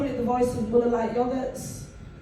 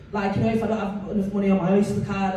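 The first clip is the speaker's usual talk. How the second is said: ordinary talk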